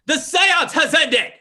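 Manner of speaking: loud, high medium's proclamation ending the seance; dramatic medium. Acted. The Raja ends the seance for his guests, in a loud, high medium's voice. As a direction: announce it like a spirit medium in a trance.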